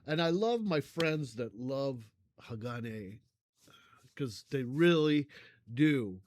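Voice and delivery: usual speaking voice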